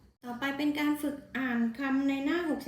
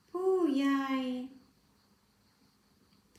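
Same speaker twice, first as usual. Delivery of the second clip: read-along word list. Shown as a demonstration. Teacher reading words one by one for the class to repeat. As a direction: give each word clearly for pupils to repeat. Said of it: "นักเรียนอ่านตามครูเลยนะคะ".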